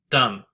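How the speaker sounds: "Dumb" hit loud, falling; stressed dumb. Shown as a demonstration. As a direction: punch the single word "Dumb". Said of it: "There's definitely stress on dumb".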